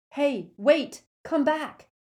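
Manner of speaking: loud, high shout; calling after someone. Acted. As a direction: call out urgently to stop her. Harry calls after the departing ghost.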